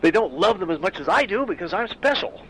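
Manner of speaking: a hint of a deluded lover's pride; obsessed man's thought. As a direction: lightly play the smug stalker who thinks he's special.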